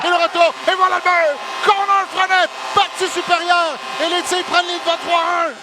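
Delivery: high, loud goal call; excited sports commentary. Real, not acted. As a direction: belt out the goal, stretching the words.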